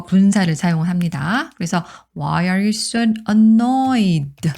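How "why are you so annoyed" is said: annoyed stretched out slowly; slow teacher read of annoyed; slow model reading. Shown as a demonstration. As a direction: say it slowly for learners. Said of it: "slow model reading".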